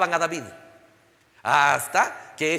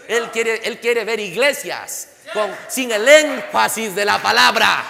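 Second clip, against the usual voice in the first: slow, loud preaching cry, much louder at the end; preaching shout; 2 semitones higher, 6 dB louder, slower than usual. Real, not acted. Preacher shouting to his congregation about the word. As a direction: drive the point home, slow and loud.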